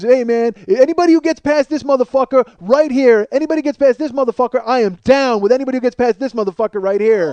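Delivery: loud, high bounty call to a crowd; shouted challenge. Retold or imitated. Rapper daring fans to slip past the guard, shouted challenge. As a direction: goad the fans to get past him.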